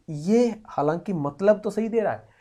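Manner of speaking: ordinary talk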